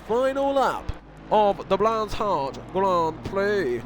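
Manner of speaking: slow, drawn-out northern accent. Real, not acted. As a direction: lean into a northern accent, slowly. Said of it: "I said it in that accent".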